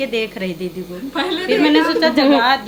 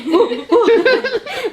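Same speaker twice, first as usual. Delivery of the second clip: a loud high laugh; 11 semitones higher, 5 dB louder than usual. Real, not acted. Helper laughing loudly at the night scare. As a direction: burst out laughing.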